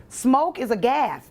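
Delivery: ordinary talk, nothing marked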